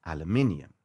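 aluminium as it is spelt; aluminium, stressed on min and pitched low; British "aluminium". Shown as a demonstration. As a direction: say every syllable of aluminium, British style. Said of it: "that's what it spells".

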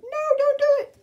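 high pleading cry. Acted. Animals begging Dingo, high pleading voice. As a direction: beg him not to. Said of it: "Look at them all going".

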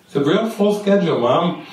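lightly voiced matter-of-fact daughter. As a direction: imitate a daughter plainly turning Mom down. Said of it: "Just goes through very matter-of-factly".

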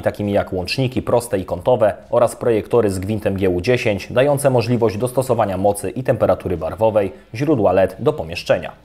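ordinary talk, nothing marked

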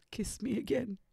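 whispered, voiceless plea. A narrator's reading. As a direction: breathe it out with no voice. Heathcliff's tender plea to Catherine.

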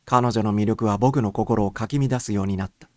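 plain reading voice

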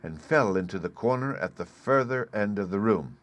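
ordinary delivery, nothing marked

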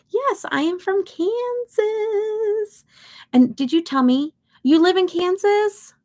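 high, drawn-out mock Kansas twang; exaggerated Kansas accent. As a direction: put on a hammed-up Kansas accent. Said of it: "I'm kidding. We don't talk like that".

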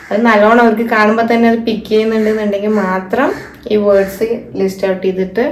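ordinary talk

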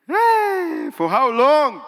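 high loud plea; anguished cry. Retold or imitated. Preacher crying out to the Lord, high and loud. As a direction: cry out to heaven in longing.

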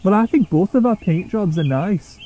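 determinedly pleasant. A narrator's reading. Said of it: "trying not to let Duncan's attitude bother her".

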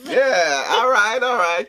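high, loud, cheering; excited. Real, not acted. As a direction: celebrate like you won. Relieved at good flavors.

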